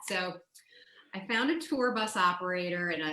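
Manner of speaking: ordinary talk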